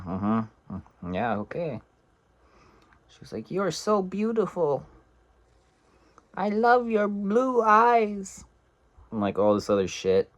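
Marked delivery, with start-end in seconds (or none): 0.1-1.8 soft, noncommittal mm-hmms; half-hearted agreement. Retold or imitated. Man humouring a gushing caller, soft half-hearted agreement. 3.5-8.4 mock gushing woman. Woman flattering her date by phone, mock high gushing.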